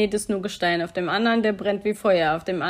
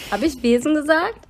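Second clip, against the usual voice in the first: doubtful; 6 semitones higher, 4 dB louder than usual. Real, not acted. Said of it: "Ich hab Wesen gesagt".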